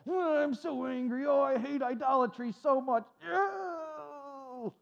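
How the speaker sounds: mock whimpering lament, voice breaking; whiny crybaby. Preacher mocking angry churchgoers with a whimpering whine.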